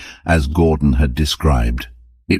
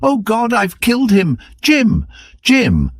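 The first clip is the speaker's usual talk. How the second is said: much higher, shrieking anguish; wild scream of agony. A narrator's reading. Wife after shooting her husband, screaming in agony. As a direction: scream his name in horror. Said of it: "a wild scream of agony".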